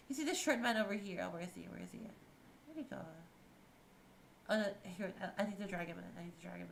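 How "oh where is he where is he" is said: ordinary delivery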